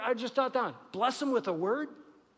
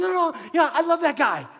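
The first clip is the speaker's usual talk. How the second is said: loud, higher, firm no no no; God insisting; 7 semitones higher, 7 dB louder than usual. Acted. Pastor voicing God, rejecting the complaint, in a loud insistent tone. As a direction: declare it over the objection.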